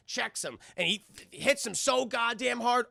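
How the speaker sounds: ordinary talk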